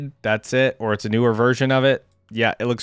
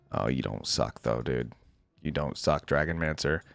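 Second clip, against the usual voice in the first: low, soft, won-over admission; quietly impressed; 10 semitones lower, 11 dB quieter than usual. Real, not acted. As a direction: admit it softly and low, giving in. Won over despite wanting to dislike.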